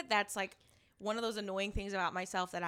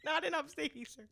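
high, breaking up with laughter; laughing hard; 13 semitones higher, 9 dB louder than usual. Real, not acted. Friend adding a disclaimer about upstate, cracking up. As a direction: struggle to speak through laughter.

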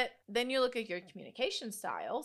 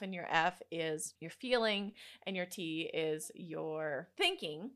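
ordinary delivery

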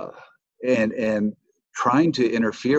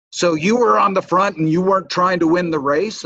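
higher, louder retold accusation; mock furious; 8 semitones higher, 7 dB louder than usual. Rival racers confront him in the parking lot, mock furious. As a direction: replay the rivals' furious demand. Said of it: "furious with me".

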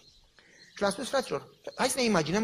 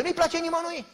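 much higher, louder preacher's punchline; emphatic answer; 12 semitones higher, 7 dB louder than usual. Real, not acted. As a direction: hammer it home, pitched high. (răspuns apăsat, mult mai sus și mai tare)

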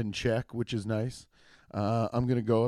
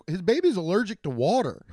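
normal talking voice

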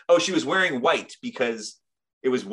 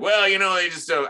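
higher, louder mock reply; exaggerated retort; 7 semitones higher, 5 dB louder than usual. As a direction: overplay the retort. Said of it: "I didn't say it like that".

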